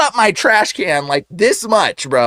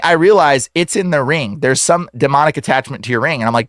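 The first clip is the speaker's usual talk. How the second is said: usual speaking voice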